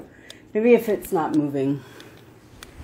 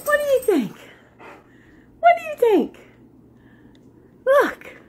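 very high, coaxing dog-talk; squeaky talking-to-the-dog voice; excited pet talk; 5 dB louder than usual. Real, not acted. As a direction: coax the dog in a high-pitched voice.

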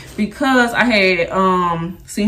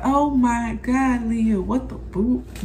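imitating T-Style; 3 semitones higher, 4 dB quieter, much slower than usual. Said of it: "And then like".

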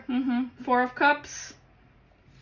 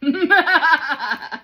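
loud, high burst of laughter; hearty laugh; 5 semitones higher, 7 dB louder than usual. Real, not acted. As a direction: let out a big triumphant laugh. Reader bursts out laughing after her claim.

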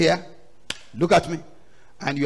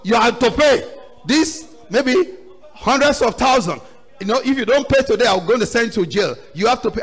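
raised, lightly acted creditor's demand; demanding master; 5 semitones higher, 5 dB louder than usual. Master demands payment from his servant, demanding. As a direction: press the debtor for payment, louder.

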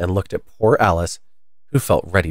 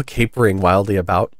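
ordinary delivery

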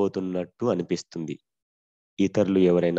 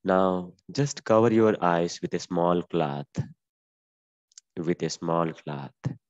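slow guided instruction; much slower than usual. Acted. Yoga teacher telling the class to cover their eyes, slow and fading.

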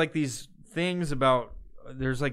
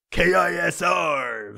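slow, loud, higher station ident; radio DJ voice; 4 semitones higher, 5 dB louder, much slower than usual. Retold or imitated. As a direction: belt out the call letters like a DJ. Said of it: "this weird fucking voice that they all do".

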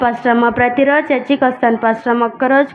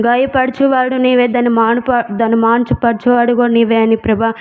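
pleading prayer to Jesus, sing-song ending on ప్రభా; a mother's tearful plea in prayer; earnest prayer. Acted. Mother prays for sick son.

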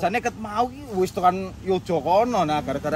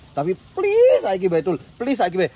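pleading; 4 semitones higher, 5 dB louder than usual. Real, not acted. Begging the late friend Entul, pleading. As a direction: beg with a long, raised "please".

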